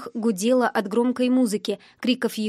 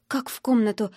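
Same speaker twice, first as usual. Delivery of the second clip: ordinary delivery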